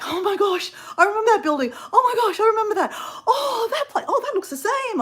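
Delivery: high, tearful, gushing with nostalgia, half laughing; like spotting your old home again after years; nostalgic gush. Retold or imitated. Tourist gushing to the Irish guy on the bus, high and tearful. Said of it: "but I'm nostalgic".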